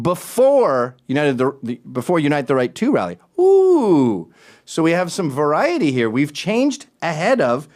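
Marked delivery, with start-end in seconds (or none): 3.4-4.3 loud, high drawn-out "ooh"; hammed-up "ooh" of awe; mock-impressed ooh. Host reacting to a barely varied headline, mock-impressed ooh.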